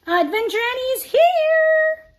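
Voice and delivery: high, loud joyful shout. Acted. Annie bursting into class, loud high shout. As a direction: burst in and announce yourself. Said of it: "I shout".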